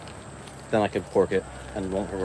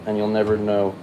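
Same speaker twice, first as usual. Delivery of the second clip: normal talking voice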